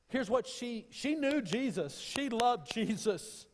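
high, loud climactic preaching cry; full-throated revival preaching; preaching at full force. Acted. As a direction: lift it to a soaring shout from the pulpit.

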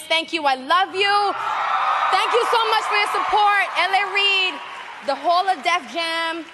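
high, loud, overjoyed thank-yous; excited thanks. Real, not acted. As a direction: gush with real joy. Award acceptance, overjoyed thanks.

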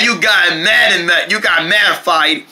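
ordinary talk

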